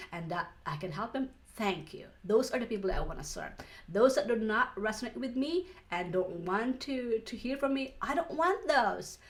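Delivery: normal talking voice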